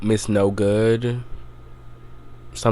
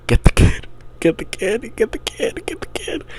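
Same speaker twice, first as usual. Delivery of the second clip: each repeat climbs higher until it breaks into a shrill breathy plea; frantic urging; 12 semitones higher, 3 dB louder than usual. Retold or imitated. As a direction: urge the screen character in mounting panic. Yelling at the screen.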